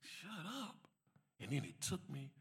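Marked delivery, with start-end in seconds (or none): 0.0-0.8 quiet, stunned repetition; stunned echo. Retold or imitated. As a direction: repeat it to yourself in disbelief. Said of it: "I was like".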